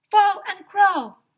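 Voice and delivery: high, loud, slow chant; kid shouting. A narrator's reading. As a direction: bellow it out. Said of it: "shouted Emily".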